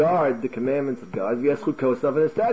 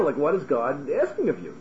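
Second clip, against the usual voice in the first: mildly incredulous, making light of it; lightly voiced dismissive question; no-big-deal intonation. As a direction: play down the demand. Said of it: "there's an intonation over there".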